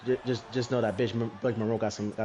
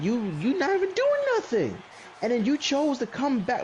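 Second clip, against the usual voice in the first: incredulous rant; 11 semitones higher than usual. Real, not acted. Ranting about wrestler's comeback.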